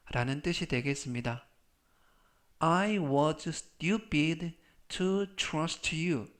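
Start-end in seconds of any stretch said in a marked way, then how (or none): 2.6-6.3 very slow, word-by-word delivery; slow model reading. Shown as a demonstration. Teacher models the trust sentence for learners, slow model reading. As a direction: read slowly so learners can repeat it.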